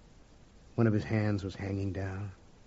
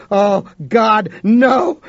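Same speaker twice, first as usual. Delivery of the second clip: high, loud panicked outcry; horrified cry; 11 semitones higher, 14 dB louder, much slower than usual. Acted. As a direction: cry out in sudden dread. Realizing the boy's danger.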